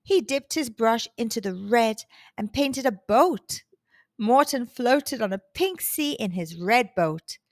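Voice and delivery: ordinary delivery, nothing marked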